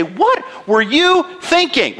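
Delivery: slow, high disbelieving rebuke; God rebuking Abram through a pagan king; exasperated God. Pretend. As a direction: ask it like you can't believe what he did. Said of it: "basically say".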